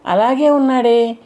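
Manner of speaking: slow, astonished exclamation in a changed voice; the mind-god, astonished the man still stands; surprised. A narrator's reading. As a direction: voice the god's disbelief, slowly. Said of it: "కంఠంలో ఆశ్చర్యం కలిగింది".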